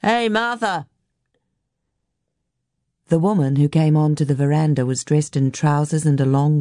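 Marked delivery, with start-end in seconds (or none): 0.0-0.9 high-pitched call toward the house. A narrator's reading. Man hailing Martha at the house, calling out. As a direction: hail someone indoors without really shouting. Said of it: "The man called toward the house".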